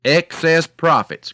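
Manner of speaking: loud, high, drawn-out protest; indignant cry. A narrator's reading. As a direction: cry it out slowly like an outraged cow. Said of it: "cried the cow".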